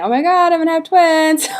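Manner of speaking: high, startled mock exclamation; mock panic. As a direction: play up shock at the size of the bump. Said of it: "I was saying".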